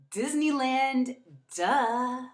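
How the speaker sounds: drawn-out duh. Real, not acted. Answering her favorite place, stretched and sassy. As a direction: say it like it's obvious.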